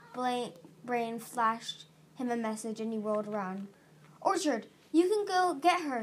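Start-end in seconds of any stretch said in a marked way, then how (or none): 4.2-6.0 high, pressing appeal; urgent yell. A narrator's reading. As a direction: yell it as a desperate instruction. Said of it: "he yelled".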